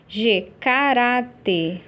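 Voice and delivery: each syllable drawn out slowly, as if dictating; slow dictation. Shown as a demonstration. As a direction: read the word slowly for the class to note. Teacher reads exercise word aloud. (leitura lenta e pausada da palavra)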